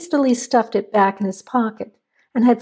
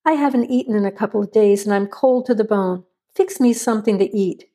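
ordinary delivery, nothing marked

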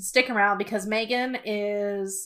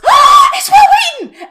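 much higher, loud, slow laughing shriek; excited fan squeal; 14 dB louder than usual. Retold or imitated. Sister spotting the celebrity in the hallway, shrieking excitedly. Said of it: "I was so embarrassed".

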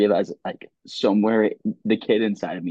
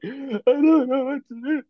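high, wobbly retold sob; imitating crying. Crying in class to his professor, high mock sob. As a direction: squeak it out like crying helplessly. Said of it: "I'm sitting there ugly crying".

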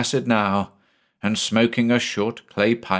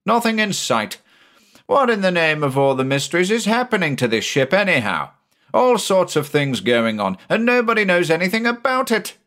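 high, fast exasperated outburst; a rattled captain losing patience; agitated; 10 semitones higher than usual. Acted. Angry skipper ranting.

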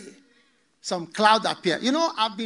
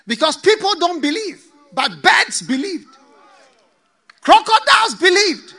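preaching shout; 5 semitones higher, 7 dB louder than usual. Acted. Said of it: "preaching climax".

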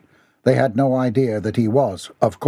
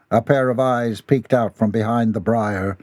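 usual speaking voice

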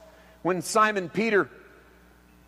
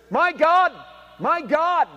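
higher, louder cry to heaven; a desperate call upward, raised and loud; anguished cry; 9 semitones higher, 4 dB louder, slower than usual. Acted. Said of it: "always screamed out of passion".

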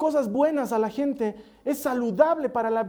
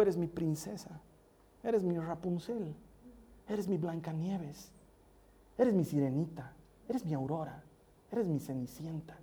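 put-on soft and slow, lowered, like tucking a child in; tender bedtime voice; 4 semitones lower, 9 dB quieter than usual. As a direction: murmur it lovingly to a sleepy daughter. Bedtime words to his daughter. (voz tierna y suave de cuento de buenas noches)

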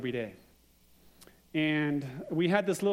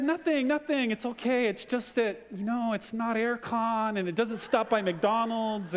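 higher, lightly voiced sheepish kids; kids' sheepish voice; 6 semitones higher than usual. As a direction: play the kids a bit cornered, brushing it off. Kids explain, voiced by dad.

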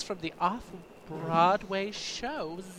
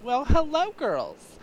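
campy host voice; 7 semitones higher, slower than usual. Acted. Opening of campy host character.

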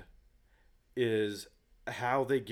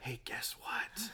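hushed, slow whisper; theater whisper; 2 semitones higher, 9 dB quieter, much slower than usual. Retold or imitated. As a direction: lean in and whisper it. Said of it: "The whispering's in the theater".

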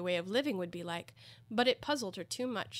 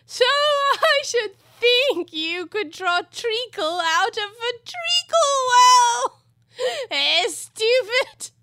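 high, very loud, drawn-out jeer; shouted taunt at a dunce; mocking Hatter; 14 dB louder, much slower than usual. Hatter belittles Alice, loudly mocking.

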